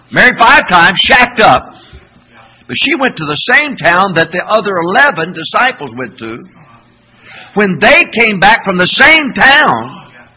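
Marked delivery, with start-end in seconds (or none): 0.1-1.6 loud, high stress on the scandal; preaching at full force, louder; 1 loud emphatic preaching. Real, not acted. 7.5-10.3 1 slow loud preaching. Real, not acted. Preaching build-up.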